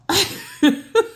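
high, loud burst of laughter; knowing laugh. Real, not acted. Traveler about to tell y'all about customs, laughing.

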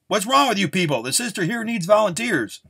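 high, loud bellow to a crowd. A narrator's reading. Gunslinger scolds the crowd for not volunteering, bellowing. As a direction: bellow it out at reluctant townsfolk. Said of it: "and then he bellowed".

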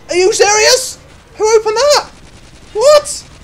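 very high, loud squeal; shocked disbelief. Real, not acted. Gamer stunned by a mistake, shrieking.

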